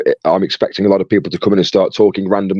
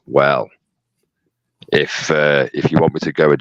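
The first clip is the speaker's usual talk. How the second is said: much lower, sultry register; sexy low voice; 8 semitones lower than usual. Shown as a demonstration. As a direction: drop your voice low and sexy. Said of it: "an octave lower, I can pull the sexy voice out".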